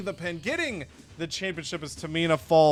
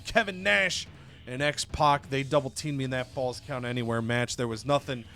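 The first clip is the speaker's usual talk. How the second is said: normal talking voice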